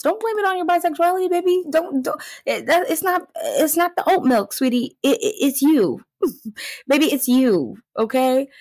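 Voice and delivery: high, loud mock scolding; sassy creator. Creator setting bisexuals straight, high loud scolding. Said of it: "And she goes".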